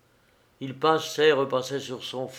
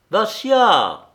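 high, loud shout falling away; desperate call to an unresponsive friend; louder cry; 10 semitones higher, 10 dB louder than usual. A narrator's reading. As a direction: shout the name much higher, more urgently. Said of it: "cria Arcade".

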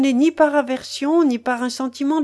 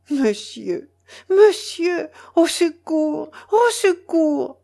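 terrified cry for help; 7 semitones higher, slower than usual. A narrator's reading. Mélanie in terror calling for help. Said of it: "épouvantée".

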